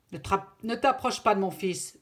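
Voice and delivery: loud, angry warning; angry threat. Acted. Father warns the lynx away from his son, angry. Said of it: "très en colère".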